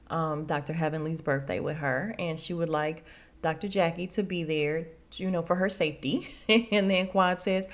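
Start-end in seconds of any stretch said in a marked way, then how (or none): none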